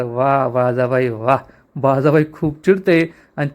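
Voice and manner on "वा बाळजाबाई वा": low, slow, sly admiring drawl; mock praise. A narrator's reading. Mainavati slyly praising Baljabai's poison plan, in a low mocking tone. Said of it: "Baljabai चांगलीच खुश होते".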